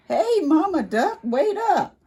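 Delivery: a cheerful little turtle calling out. Acted. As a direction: shout after her to wait. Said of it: "that turtle was saying".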